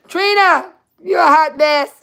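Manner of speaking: high, loud playful teasing; amused teasing. Real, not acted. Teasing Trina over her mix-up, loud amused call. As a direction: tease her through the giggles. Teasing caller while laughing.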